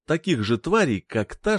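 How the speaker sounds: usual speaking voice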